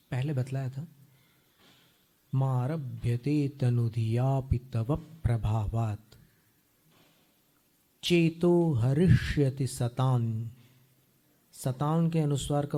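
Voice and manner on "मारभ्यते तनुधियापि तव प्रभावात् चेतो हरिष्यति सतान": slow recitation. Shown as a demonstration. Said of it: "teacher reciting verse slowly".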